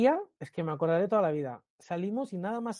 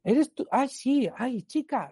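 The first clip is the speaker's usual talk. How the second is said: put-on much higher, gushing older lady voice; excited lady; 8 semitones higher, 4 dB louder, slower than usual. Lady in the metro recognising him, high gushing voice. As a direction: squeal it like a delighted fan spotting him. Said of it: "una señora de frente me dice".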